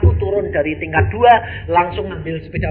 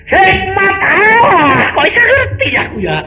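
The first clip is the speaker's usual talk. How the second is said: fervent wonder; 10 dB louder than usual. Real, not acted. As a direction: marvel aloud, high and loud. Preacher's emotional wonder.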